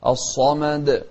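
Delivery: الصمد with a full heavy, emphatic ص; الصمد, ص pronounced heavy from the back of the mouth; heavy emphatic sad. Shown as a demonstration. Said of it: "الصاد من أحرف التفخيم".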